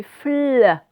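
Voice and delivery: "fl" loud, as f running into a long held l; isolated blend sound. Shown as a demonstration. Teacher showing pupils the learned sound, held fl blend. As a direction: hold the l in "fl". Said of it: "Et le son".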